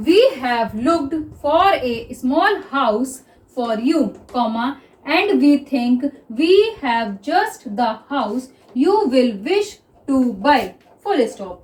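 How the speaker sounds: very slow, word by word dictation; slow dictation read. Shown as a demonstration. Teacher reading the passage slowly to shorthand students. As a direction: spell out each word slowly. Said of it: "ध्यान से देखिएगा कि मैं इसको किस तरह से read कर रही हूँ".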